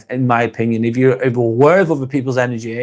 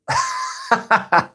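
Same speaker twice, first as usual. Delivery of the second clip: high-pitched burst of laughter; helpless laugh. Real, not acted. Streamer baffled by a comment about bread, cracking up laughing. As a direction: crack up mid-question.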